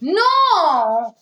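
loud shocked no. Real, not acted. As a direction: react with real shock.

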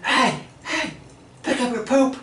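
high, shrill-free mock yell of an angry neighbor lady; imitated lady yelling. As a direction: squawk it up high like the lady from the car window. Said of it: "when people yell at me".

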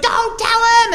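very high, mock urgent warning; hammed-up alarmed father; urgent dad. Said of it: "he's like".

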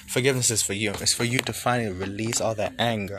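normal talking voice

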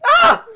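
startled yell. Retold or imitated. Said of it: "And I was like".

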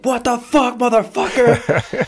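high-pitched mock dad outburst; drawn-out, high retold yell; angry yelling. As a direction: imitate an irate dad on the phone. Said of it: "he's just yelling at me".

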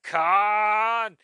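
high, loud drawn-out shout; Captain Kirk. Retold or imitated. Recalling Star Trek, Captain Kirk's long anguished yell. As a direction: bellow the name like Kirk. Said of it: "Captain Kirk and he was like".

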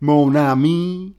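playful demo of a Cajun accent. Shown as a demonstration. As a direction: stretch "Mon Ami" into a Cajun drawl. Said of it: "είναι περισσότερο κάπως έτσι".

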